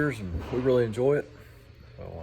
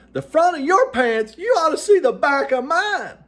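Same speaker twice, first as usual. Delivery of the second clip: much higher, much louder joke punchline shout; passenger yelling; 12 dB louder than usual. Retold or imitated. Joke passenger shouts back at the pilot, high loud yell. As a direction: yell it across the cabin, pitched way up. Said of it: "a passenger yelled out".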